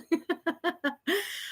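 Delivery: soft high giggle; giddy laugh. Real, not acted. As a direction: laugh at your own excitement. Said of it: "Can you tell?".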